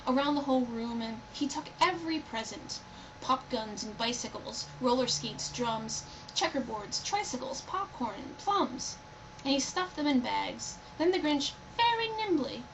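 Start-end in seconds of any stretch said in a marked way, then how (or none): none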